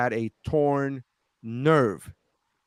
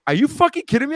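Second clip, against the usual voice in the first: incredulous; 10 semitones higher, 5 dB louder, faster than usual. Retold or imitated. Fellow comedian's retold reaction.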